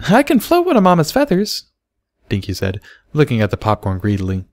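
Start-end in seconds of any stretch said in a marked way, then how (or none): 0.1-1.6 put-on very high little girl voice; high childlike brag; proud filly. A narrator's reading. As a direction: boast like a small child.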